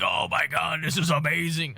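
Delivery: lightly put-on coach voice, badly faked; slightly hammed-up, unconvincing coach impression; bad McVay impression. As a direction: do a poor impression of an amazed coach. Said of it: "That was a terrible impression".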